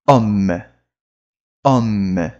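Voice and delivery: "homme" starting straight on the O, no H; low-pitched "homme" closing on M; silent H. Shown as a demonstration. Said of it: "ele não pronuncia".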